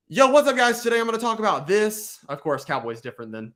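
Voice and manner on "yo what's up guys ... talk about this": high, punchy short-video opener; YouTuber intro. Acted. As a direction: greet viewers like an upbeat YouTuber. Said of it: "make a short where you're like".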